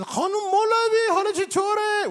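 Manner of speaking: put-on high, loud old man greeting in a thick Farsi accent; hammed-up old men calling out in Farsi. Two old neighbours greeting his mom across the street, thick Farsi accent. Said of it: "except very deep accents like this".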